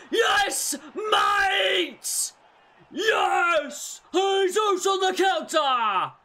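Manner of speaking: high, loud, elated yelling; ecstatic goal shout. Real, not acted. Gamer roaring as his striker scores on the counter. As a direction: roar your striker home.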